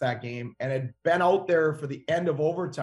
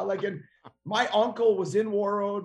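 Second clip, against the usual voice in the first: normal talking voice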